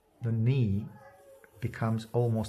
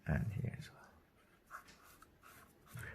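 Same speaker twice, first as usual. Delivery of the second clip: slow whisper to himself while shading; whispered aside; 3 semitones lower, 15 dB quieter, much slower than usual. Real, not acted. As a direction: breathe it out, barely voiced, slowly. Painter murmuring while working.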